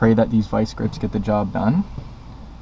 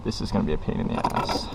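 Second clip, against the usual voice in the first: muttered grumble; 5 semitones lower, 6 dB quieter, faster than usual. Real, not acted. Grumbling aside under breath.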